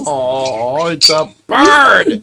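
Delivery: slow, loud "it's a bird!" in real surprise; delighted surprise. Real, not acted. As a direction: gasp it out, thrilled to spot a bird.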